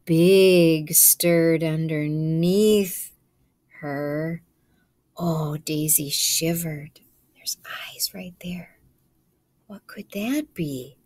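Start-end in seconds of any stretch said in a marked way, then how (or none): 0.1-4.4 suspenseful narration. A narrator's reading. Suspenseful story opening. 7.3-11.0 whisper. Real, not acted. Whispered aside to children.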